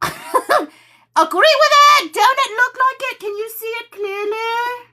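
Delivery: high, excited squeal after a laugh; high-pitched insistence. Real, not acted. Asking viewers if they can see it, high insistence. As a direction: press them to agree, pitch way up.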